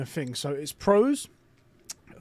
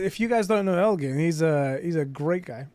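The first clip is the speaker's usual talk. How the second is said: ordinary talk, nothing marked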